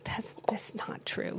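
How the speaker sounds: unvoiced whispered denial; whispering. Real, not acted. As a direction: breathe it out with no voice. Teasing denial.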